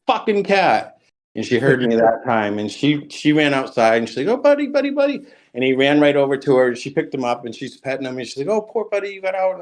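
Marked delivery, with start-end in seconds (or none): 0.1-1.0 high-pitched mock yell at his wife; yelling for her to come get the cat; retold yell. Yelling at wife to fetch the escaped cat, mock angry shout. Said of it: "this time I yelled a lot louder". 4.3-5.2 cooing wife. Retold or imitated. Wife calling the escaped cat. 8.5-9.6 cooing wife. Retold or imitated. Wife comforting cat.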